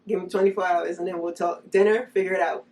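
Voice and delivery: loud, fed-up orders; mock demanding. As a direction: bark it like a fed-up mom. Imagined group text to kids.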